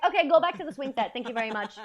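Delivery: mock dismissive teacher. Substitute teacher dismissing a trolling eight-year-old, loud and brisk. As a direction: shoo the child away, fast and loud. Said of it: "And I was like".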